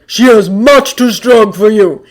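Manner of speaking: loud slow warning. A narrator's reading. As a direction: call the warning out. Thor warning loudly.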